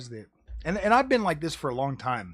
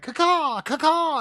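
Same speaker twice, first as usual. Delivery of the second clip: high, loud squawking "caw-caw"; imitated bird caws; 10 semitones higher, 6 dB louder, slower than usual. Streamer imitating checkerbirds on the fence, high loud cawing. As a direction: squawk like birds coming home to roost. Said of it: "They're like".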